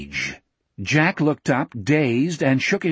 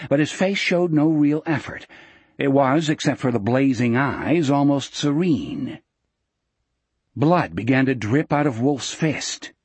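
ordinary delivery, nothing marked

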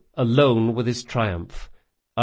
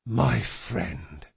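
grieving murmur; 5 dB quieter than usual. Acted. Grieving over the dead body.

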